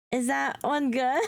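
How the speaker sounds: normal talking voice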